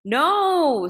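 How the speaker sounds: high, loud frightened no; sharp child's cry of no; child's loud refusal. A narrator's reading. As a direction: refuse like a scared little girl. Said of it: "said Sana".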